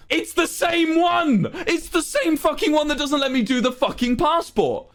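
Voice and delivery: high, half-laughing, half-crying outrage; exasperated laughing rant. Real, not acted. Telling chat about the same website, disbelieving rant.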